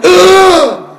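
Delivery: loud, high gasp for air from under water; retold gasp. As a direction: burst out gasping as if half drowned. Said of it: "and the disciple went".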